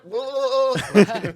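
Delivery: very high, loud stretched whoa; surprised whoa. Real, not acted. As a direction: let out a big astonished whoa. Reacting to eighteen plus.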